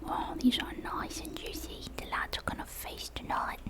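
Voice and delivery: put-on hushed, gloating boy in a character accent; scrappy frog boy, pleased. Frog boy receives a jar of fireflies, quietly pleased character voice. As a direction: relish the catch softly, in a put-on boy's voice.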